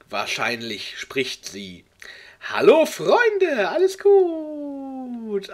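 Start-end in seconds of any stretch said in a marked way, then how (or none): 2.4-5.5 much higher and slowed right down, drawn out; mock sing-song reading. Shown as a demonstration. Reader mocking the fanfic greeting in a high drawn sing-song. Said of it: "Oh, Alter, dieser Satz".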